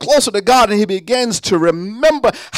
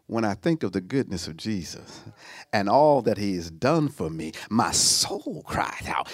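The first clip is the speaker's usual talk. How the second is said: put-on old school preacher; 11 semitones lower, 12 dB quieter than usual. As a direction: draw out the lines like an old hymn-lined sermon. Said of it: "Old school would say it like this".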